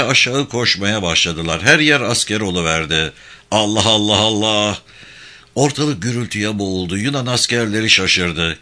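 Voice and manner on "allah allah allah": chanted war cry in an altered voice; battle cry. Acted. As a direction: voice a charging troop's cry. Soldiers' battle cry during the charge. (savaş narası)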